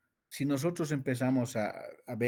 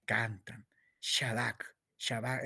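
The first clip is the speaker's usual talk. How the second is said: soft whisper of "Shadak"; 5 dB quieter, slower than usual. Real, not acted. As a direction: breathe the words out. Susurra la palabra hebrea. (susurrado suave)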